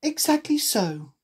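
put-on high-pitched girl voice; storybook heroine, high; Alice. A narrator's reading. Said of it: "said Alice".

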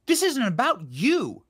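fed-up indignation; indignant outburst. Real, not acted. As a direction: snap it at the screen in disbelief. Ranting at clip of Trump.

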